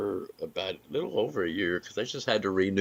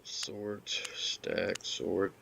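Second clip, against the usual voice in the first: whispering; 11 dB quieter, much slower than usual. Real, not acted. Muttering to self while sorting.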